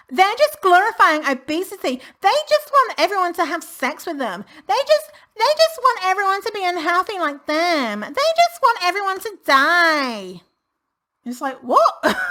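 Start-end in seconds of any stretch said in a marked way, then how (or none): 0.1-10.4 high, sneering caricature of a hater; mock the smug commenter, pitched up. Vlogger mocking online commenters in a high sneering voice. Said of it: "motherfuckers up in these comments saying". 11.6-12.2 high incredulous yelp; a disbelieving squeak; baffled cry. Pretend. Vlogger mocking her own disbelief with a high yelp. Reacting to absurd accusation.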